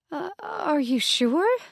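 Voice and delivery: surprised. Acted. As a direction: ask it slowly in disbelief. Eyes widened, surprised.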